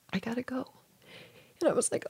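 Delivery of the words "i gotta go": soft whispered goodbye; whispered farewell. Retold or imitated. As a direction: breathe it gently as a goodbye. Husband's farewell in dream.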